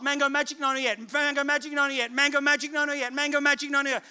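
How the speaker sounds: sing-song repetition, no let-up between asking and answer; pestering children. Retold or imitated. Kids ask dad after every shop, mock pestering on repeat. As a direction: imitate kids nagging on a loop. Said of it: "They're not being annoying".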